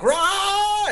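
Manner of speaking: high, loud mock roar; imitated monster roar. Shot perp wakes up and grabs a cop, roaring. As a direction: roar like a perp jolting awake. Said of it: "and goes like".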